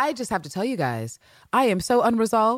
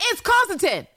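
high, excited shout; retold outburst, pitched way up; exclaimed accusation; 12 semitones higher, 4 dB louder than usual. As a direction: cry it out like a big discovery. Retelling her own shocked accusation.

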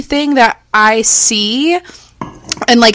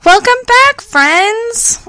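high mock greeting; 13 semitones higher, much slower than usual. Mock greeting, echoing co-host.

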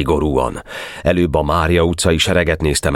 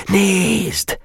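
excited exclamation. A narrator's reading. Boys point out to each other.

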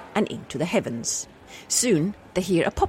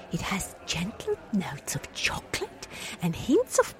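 whisper; 2 semitones higher, 8 dB quieter than usual. Acted. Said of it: "Nice quick fire voices there".

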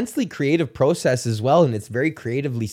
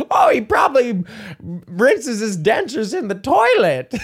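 put-on higher, slow yelled old man taunt; old man shout; 8 semitones higher, slower than usual. Old bully shouting an insult about dentures, slow and high. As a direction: holler it like a rowdy old geezer. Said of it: "one of them's like".

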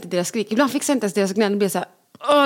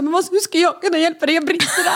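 exasperated mom; 8 semitones higher than usual. Retold or imitated. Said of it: "Man säger".

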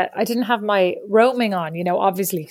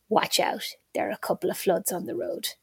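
whispered warning; 3 semitones lower, 9 dB quieter than usual. Retold or imitated. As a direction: hush the warning. Dad's phone warning.

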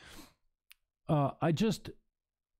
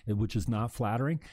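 ordinary talk

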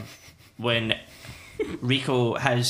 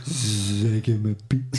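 dreamy slow voice; 3 semitones lower, much slower than usual. Retold or imitated. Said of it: "repeating the line".

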